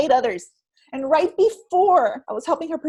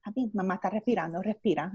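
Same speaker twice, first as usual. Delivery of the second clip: soft, low, quick murmur; soothing parent; 6 semitones lower, 8 dB quieter, faster than usual. Retold or imitated. Mother to tantruming two-year-old in Spanish, softly soothing. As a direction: gently coax a small child to breathe.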